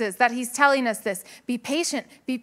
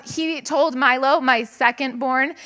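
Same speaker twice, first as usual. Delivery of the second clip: usual speaking voice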